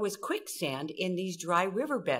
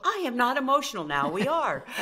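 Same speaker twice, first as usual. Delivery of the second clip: mock defensive denial; 4 semitones higher, 5 dB louder than usual.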